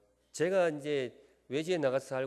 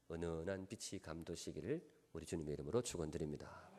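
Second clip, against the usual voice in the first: normal talking voice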